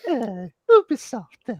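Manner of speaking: very high, silly cooing; cheek-pinching baby talk. Pretend. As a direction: gush as if squeezing someone's cheek. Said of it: "you just grab someone's cheek".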